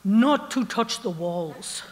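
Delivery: put-on slow guard's warning in a thick Egyptian accent. Guard leans in to warn the tourist, thick Egyptian accent. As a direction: imitate an Egyptian guard's heavy accent, drawn out. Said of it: "in a thick accent, he says".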